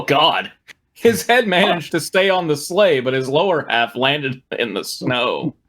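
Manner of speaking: ordinary talk, nothing marked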